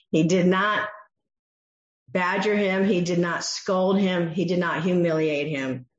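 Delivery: normal talking voice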